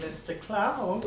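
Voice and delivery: creepy clown voice. Acted. Creepy clown voice in horror bit.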